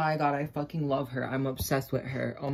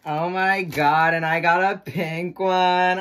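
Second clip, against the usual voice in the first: loud slow delight; 9 dB louder, slower than usual. Real, not acted. Gets the pink one.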